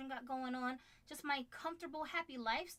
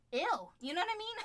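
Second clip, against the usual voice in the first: a quick, louder "ew" of distaste; mock disgust; 9 semitones higher, 4 dB louder than usual. Wife mocking the girls' boyfriends with a loud ew. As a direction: wrinkle your nose at it.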